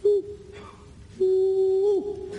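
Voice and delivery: high hooting woo answering from the cave; bunyip reply call. Shown as a demonstration. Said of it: "you'll hear the bunyip at the back of the cave reply".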